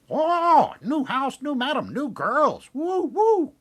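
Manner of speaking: very high, slow, screechy parrot voice; squawking parrot. Acted. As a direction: squawk it out like a parrot in a new home. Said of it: "Parrot goes".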